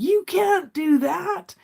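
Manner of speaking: high, drawn-out outraged protest; freaking out. Retold or imitated. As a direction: imitate a brother freaking out, pitch way up, words stretched. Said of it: "he freaked right out on me".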